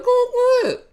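high-pitched imitated nudge; urging. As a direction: egg them on in a high voice. Acts Diamond urging him.

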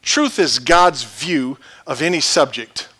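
normal talking voice

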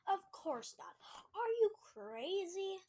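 high, loud indignant disbelief; loud, drawn-out incredulous protest; indignant outburst. Acted. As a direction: refuse as if it's outrageous. Hunter indignantly rejects the offer.

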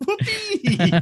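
very high squealed cheer, dissolving into laughter; Garfield. Acted. Podcaster repeats the cat's catchphrase, laughing high squeal. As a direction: squeal it like a cartoon cat, cracking up. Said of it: "looked up and going".

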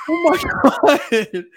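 slow, loud, high laughing cry; laughing shout. Retold or imitated. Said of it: "I'm like".

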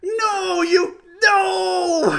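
high, loud, laughing cries of disbelief; shocked laughing shout. Retold or imitated. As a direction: yell "what" through laughter, aghast. Dad's shocked reaction to son.

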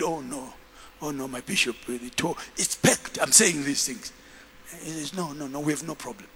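low, very soft imitation of a man; hushed, low mock protest; retold meek denial. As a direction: play a husband quietly brushing it off. Acts out husband denying marital trouble.

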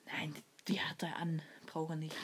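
hushed whisper. Real, not acted. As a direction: drop to a whisper. Quiet aside during packing game.

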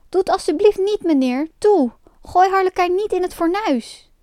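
high-pitched anxious begging; pleading. A narrator's reading. Pinocchio begs for Harlekijn to be spared, pleading. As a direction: beg him to spare your friend.

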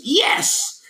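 put-on high, hokey kid's cheer; mock triumphant yes. Mocking how movie children celebrate anything, high mock cheer. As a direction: imitate a movie child's squealed victory cry. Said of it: "I've never met a child who, anytime they do something, goes".

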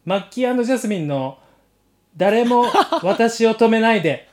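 normal talking voice

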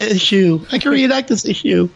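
put-on high, gleeful childlike voice; mock kid excitement. Remembering his toy helmet, high childish glee. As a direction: squeal it like a proud little kid.

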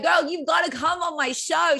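put-on high-pitched girlfriend-style urging; excited retold invitation. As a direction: urge a friend eagerly, pitch lifted well up. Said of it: "I was like".

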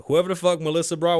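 normal talking voice

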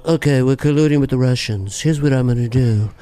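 low-pitched impersonation of a president scheming aloud; Donald Trump. Acted. President announcing his plan, low Trump impression. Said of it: "the idea of Trump is".

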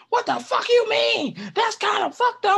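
loud, high, indignant yell; angry outburst. Retold or imitated. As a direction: snap back in outrage. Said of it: "And everybody just flipped".

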